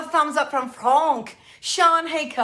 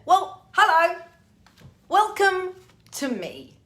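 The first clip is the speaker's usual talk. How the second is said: mock grand welcome; 6 semitones higher, 5 dB louder, slower than usual. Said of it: "This is who I have become".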